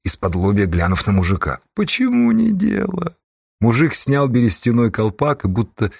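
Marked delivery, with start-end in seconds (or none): 1.7-3.2 high, slow, honeyed question; affectionate, gentle. A narrator's reading. Forest stranger questions the gang leader, tenderly. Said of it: "раздался вдруг ласковый голос".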